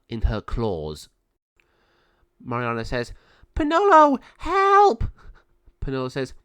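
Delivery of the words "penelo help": very high, loud scream; cry for help. Acted. Girl caught by the raven, high scream for help.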